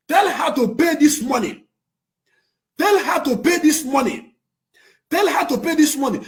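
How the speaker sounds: insistent demand. Real, not acted. Repeated demand to pay money.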